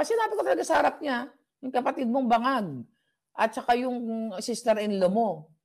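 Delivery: rushed, high-pitched brag about speaking up to her face; excited boast. Real, not acted. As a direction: tell it like you can't believe you dared. Boasting of confronting the senator.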